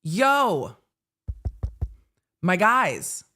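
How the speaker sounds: rising, incredulous mock rant; mock outrage. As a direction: act out the defense calling in disbelief. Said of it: "The defense team called Arca and went".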